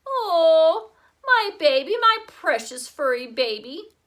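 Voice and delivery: put-on high, loud baby-talk cooing over a puppy. A narrator's reading. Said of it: "she cooed".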